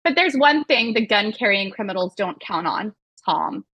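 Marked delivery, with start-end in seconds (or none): none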